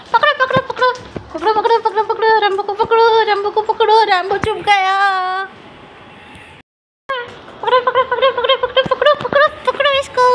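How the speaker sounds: very high, excited squeaky chanting; playful squeal. Real, not acted. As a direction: squeal while chasing the dog in play. Playful chase with dog.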